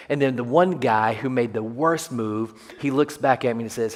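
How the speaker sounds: usual speaking voice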